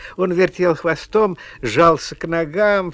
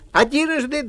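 high, raised announcing call; announcing showman; 11 semitones higher than usual. Acted. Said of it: "сказал Ваня".

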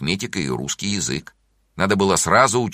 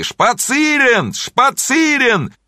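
very high, slow, stretched-out yells; pupils taking up the cry; 6 dB louder, much slower than usual. A narrator's reading. Pupils joining the cry to skip class, high wild shouting. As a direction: echo the wild cry, loud and drawn out. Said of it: "многие ученики подхватывали".

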